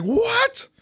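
very high mock-shocked squeal; shocked disbelief. Player told his mission is over, shocked disbelief. Retold shock at game message.

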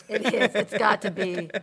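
laughing while speaking. Real, not acted. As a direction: laugh through the words. Laughing at the Bible joke.

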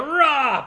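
drawn-out punch roar "raah"; mock battle roar. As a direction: imitate a fighter's punch roar. Imitates robot's punch.

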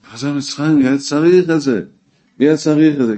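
a preacher's loud, high rhetorical question; emphatic outcry. Real, not acted.

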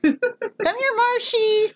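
very high sing-song pet call; calling a dog. Pretend. Pretending to call the dog Marshy, high coaxing call. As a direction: coax a pet over in a squeaky voice.

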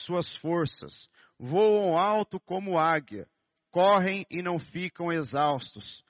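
fervent sermon reading of the verse; scripture reading. Acted. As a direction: proclaim each phrase like a promise. Pastor reads Isaiah 40:31.

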